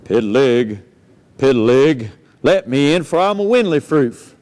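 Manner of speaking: wolf growling at the pig's door; big bad wolf. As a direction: drop way down like a menacing wolf. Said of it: "And he says".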